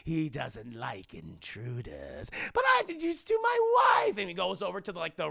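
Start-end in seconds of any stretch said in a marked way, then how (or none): 0.1-2.3 slow villain drawl sinking low; mock-apologetic cartoon villain; Joker. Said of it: "It's like". 2.5-4.2 high, loud gleeful villain; theatrical shouted introduction; Joker. Acted. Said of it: "And Joker's like".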